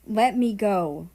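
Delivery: loud, slow spat-out order; a hurt friend pushing the other away, angrily; pissed. Acted. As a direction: spit the words out in anger. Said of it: "America spat out in a pissed tone".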